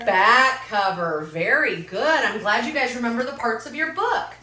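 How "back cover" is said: drawn out, a little louder; slow emphatic echo. Shown as a demonstration. Teacher repeating a pupil's answer, slow and emphatic. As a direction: stretch each word so the class hears it.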